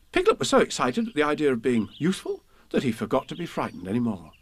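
usual speaking voice

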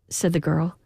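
usual speaking voice

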